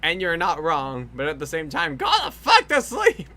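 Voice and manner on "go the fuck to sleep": high, loud scolding yell; mock yell. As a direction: yell it like a fed-up parent. Scolding friend for staying up.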